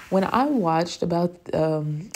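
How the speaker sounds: ordinary delivery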